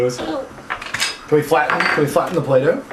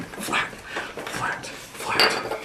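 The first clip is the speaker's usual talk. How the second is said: whispered flat; 10 dB quieter, much slower than usual. Acted. Whispering flat while playing.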